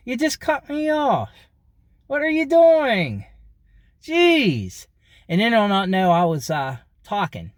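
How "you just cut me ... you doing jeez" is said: very high, drawn-out exasperated yell; without moving lips. Shown as a demonstration. Driver yelling at whoever cut in, high, lips unmoving. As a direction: squawk it through motionless lips. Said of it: "I'll go like this".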